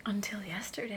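whispered, sneaky qualification; unspoken afterthought. Acted. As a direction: tack it on under your breath. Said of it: "And then in your head".

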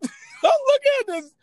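high, laughing repeat. Real, not acted. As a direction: laugh through the line, way up high.

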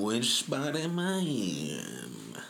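drawn-out musing repeat; slow, drawn out. Shown as a demonstration. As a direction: stretch every word of "Which spot am I in?". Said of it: "repeats the question slower".

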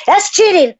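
indignant protest. Real, not acted. Protesting to Luke that it's cheating. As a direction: call foul, loud and high.

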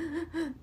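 short panicked gasp; sharp gasp for air. Shown as a demonstration. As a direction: gasp like you can't catch your breath. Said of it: "where you can't catch your breath".

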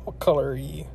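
ordinary talk

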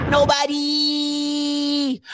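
very high, very slow drawn-out mimic; mocking a friend in a high drawn-out voice; Timona's high-pitched voice. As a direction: stretch the word out in a high imitation. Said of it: "in her high-pitched voice".

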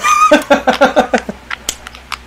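high, loud peal of laughter; real high-pitched laughing fit. Real, not acted. Laughing fit after a squeak.

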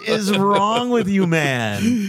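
high, slow, loud exaggerated outrage; mock outrage. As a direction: drag it out in hammy disbelief. Mock outrage at friend's jinx.

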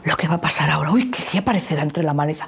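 eerie read-aloud with a sudden gasp; suspenseful reading. Shown as a demonstration. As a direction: build the fear like reading a scary tale.

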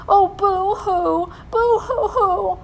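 high, drawn-out mock wail; boo-hoo in hammed-up sobs; theatrical sobbing. A narrator's reading. Witchy lady crying in the dark, theatrical sobbing. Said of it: "came the sound of sobbing, much louder".

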